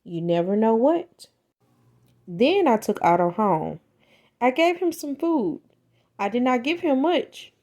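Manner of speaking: plain reading voice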